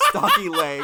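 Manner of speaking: bursting into a high laugh. Real, not acted. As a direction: laugh out the words. Teasing friend about his belly.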